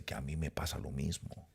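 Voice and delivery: soft, secretive hush pulled into a corner. Retold or imitated. Pastor pulls him aside to confide, secretive hush. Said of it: "como que me iba a contar un secreto".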